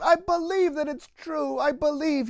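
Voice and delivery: high, excited outburst of belief; bursting out. Acted. Donkey bursts out to the kind man, high and excited. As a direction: blurt it out, overjoyed and amazed. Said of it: "The donkey burst out".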